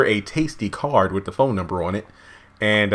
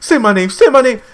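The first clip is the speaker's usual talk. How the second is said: much higher and louder, needy chant; high mock pleading wife; 12 semitones higher, 7 dB louder than usual. Wife begging husband for her name, high mock pleading. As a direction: beg it up high like a lovestruck wife.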